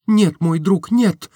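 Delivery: put-on young woman interrupting. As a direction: stop him mid-sentence. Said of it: "перебила она".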